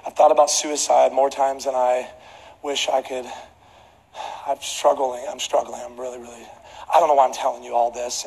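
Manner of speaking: low, quiet, halting confession; retold despair. Airline passenger confiding in a stranger, subdued retold despair. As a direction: trail off softly, imitating a man struggling to admit it.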